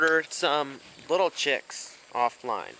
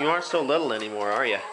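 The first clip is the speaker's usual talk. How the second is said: ordinary delivery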